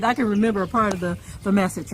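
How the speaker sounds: ordinary delivery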